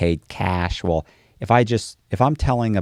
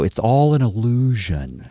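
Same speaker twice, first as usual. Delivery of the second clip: slow, stretched-out teasing reveal; playfully drawn-out punchline; mock-mysterious reveal; slower than usual. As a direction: draw the words out like unveiling a trick. Teasing reveal in retold exchange.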